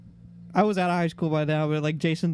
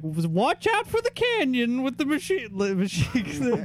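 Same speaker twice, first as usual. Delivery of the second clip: put-on high, slow old prospector voice; Will Ferrell's prospector, drawn out; 6 semitones higher, slower than usual. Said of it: "they have an old prospector ... it's Will Ferrell".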